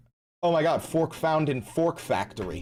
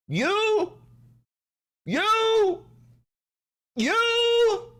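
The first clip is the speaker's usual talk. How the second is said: mock defiant taunt; 13 semitones higher, slower than usual. Daring chatter Avi to stop him, high and shouting.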